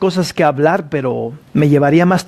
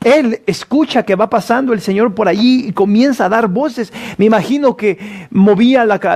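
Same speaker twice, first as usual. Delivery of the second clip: raised, urgent sermon voice; fervent preaching; 4 semitones higher than usual. Acted. Preacher urging listeners to come to Jesus, fervent preaching. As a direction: preach it to the congregation.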